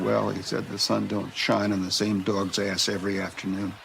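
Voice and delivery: usual speaking voice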